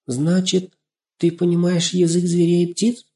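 put-on high, wondering girl's voice; surprised. A narrator's reading. Girl learning her friend understands animals, surprised. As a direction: marvel at what was just learnt. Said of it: "удивлённо воскликнула Настенька".

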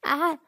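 sharp high yelp of pain; pained cry. A narrator's reading. Hit in the stomach by Lâm Nguyệt, a sharp yelp. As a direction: yelp as the elbow lands. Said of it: "bị đánh đau đến tái mặt".

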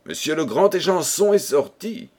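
ordinary delivery

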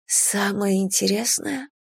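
slow rising echo on "интересное"; questioning echo. A narrator's reading. A woman repeating "Самое интересное" back, questioning. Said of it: "переспрашиваю я".

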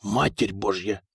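low, soft, slow gasped prayer; hushed, stunned exclamation; horrified oath. Acted. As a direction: breathe the oath in dismay, slowly. Foka pales at the news.

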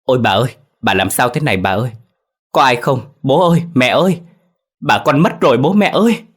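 horrified wail for her parents; panicked crying out. Acted. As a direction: call for help in horror, voice shooting up. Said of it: "Chị vội la lên rồi chạy tới".

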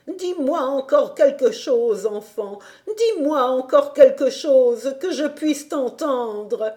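put-on longing to hear the child; gentle plea. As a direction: grandmother asking tenderly. Grandmother pleading.

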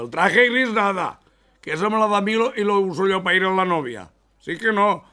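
high, loud refusal; indignant shout. Acted. Son refusing his grey suit, shouting high. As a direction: yell it, you won't give up the grey suit.

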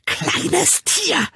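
very high, screeched insult; wütendes Kreischen. A narrator's reading. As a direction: screech it in rage. Mother screams insult at child. (schrill und wütend kreischen)

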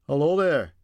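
put-on Scottish-accented walker's greeting. A narrator's reading. Walker hailing the couple he is passing, Scottish accent. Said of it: "said the man in a Scottish accent".

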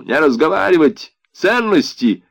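high, loud soldier's commands; shouted order. A narrator's reading. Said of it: "крикнул". (резкий крик-приказ)

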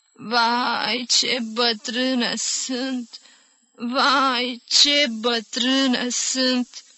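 put-on slow, high old-woman chant; singsong lament. A narrator's reading. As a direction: chant it on, lost in your own world. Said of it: "cânta mai departe bătrâna, neînțelegând".

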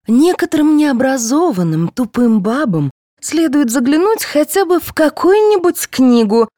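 high, biting put-down; spiteful. A narrator's reading. Actress insulting her uneducated colleague, spitefully. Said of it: "зло сказала Рашидова".

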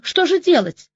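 high, panicky question; frightened. A narrator's reading. Heroine panicking over the girl in labour, frightened. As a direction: ask in real alarm. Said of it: "перепугалась я по-настоящему".

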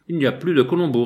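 Colombo jeté sèchement, plus fort et plus haut; réponse sèche. Acted. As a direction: couper court, sec et dur. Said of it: "répondis-je sèchement". (sèchement, plus fort)

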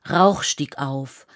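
ordinary talk

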